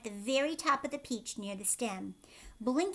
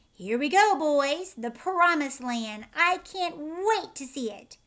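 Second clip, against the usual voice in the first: loud excited cheering; rallying excited cry; excited shout; 5 semitones higher, 8 dB louder, slower than usual. A narrator's reading. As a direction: shout it with thrill. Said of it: "Amidst mounting excitement and shouts".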